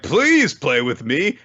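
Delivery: high, slow mock plea; mock pleading. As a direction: beg sweetly and slowly, hammed-up. Imagined Yukito begging.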